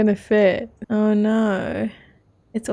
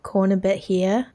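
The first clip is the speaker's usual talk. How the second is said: ordinary delivery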